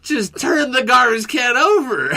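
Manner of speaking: can't believe him, laugh it out; exasperated laughing advice; laughing disbelief. Retold or imitated. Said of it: "I'm like, I'm like".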